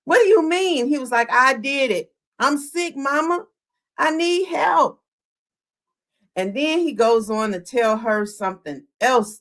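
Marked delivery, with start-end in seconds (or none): 0.0-0.9 higher, louder mock outcry; acted disbelief, pitch climbing; upset retold yell. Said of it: "she's screaming, yelling, she's upset". 1.3-5.0 higher-pitched pleading son; retold plea, voice lifted high; acted confession.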